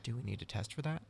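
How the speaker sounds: very soft, serious doctor; all seriousness. Retold or imitated. Doctor takes patient's joke seriously, asking in a hushed tone. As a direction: ask it quietly, as if truly concerned. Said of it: "looks at me all seriousness".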